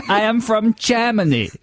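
high, hammed-up cartoon German; German accent. Co-host tops his joke with a second line, comic German accent. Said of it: "if a German person said the word German".

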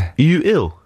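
"Are you ill" run together like a surname; pronunciation as wordplay. Shown as a demonstration. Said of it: "How would you pronounce that?".

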